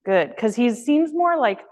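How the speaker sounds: ordinary talk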